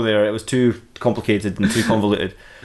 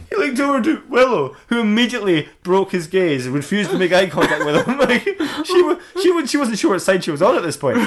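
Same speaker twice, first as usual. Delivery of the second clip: laughing; 8 semitones higher than usual. Real, not acted. Laughing through narration.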